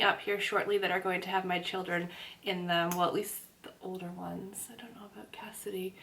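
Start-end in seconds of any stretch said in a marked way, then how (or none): 2.9-5.9 breathy whispered aside. Real, not acted. Private aside about the children.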